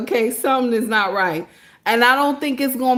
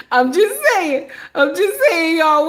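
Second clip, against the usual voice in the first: laughing; 6 semitones higher, 4 dB louder than usual. Real, not acted. Laughing through repeated phrase.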